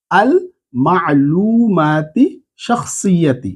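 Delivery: মা'লুমাতি stretched very slowly, then শাখসিয়াতি falling; very slow repeat. Shown as a demonstration. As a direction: drag each syllable out for repetition. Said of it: "আমার সাথে সাথে এটা পড়ে নেন". (আরও ধীরে টেনে টেনে)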